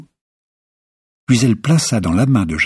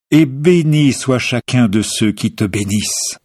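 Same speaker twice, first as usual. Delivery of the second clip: a higher, louder, ringing proclamation; solemn blessing; 6 semitones higher, 5 dB louder than usual. A narrator's reading. Old father Isaac blessing his son, loud solemn proclamation. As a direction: pronounce the blessing over your son with full force. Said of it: "il se mit à le bénir et à dire".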